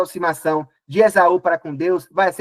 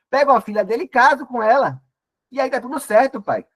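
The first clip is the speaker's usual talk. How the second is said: higher, eager promise; eager reassuring son; 7 semitones higher than usual. Acted. Esau assures his father he will keep the line, higher and eager. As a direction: sell the plan to father like a keen young man. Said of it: "ele vai e fala".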